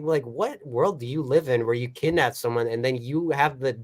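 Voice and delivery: normal talking voice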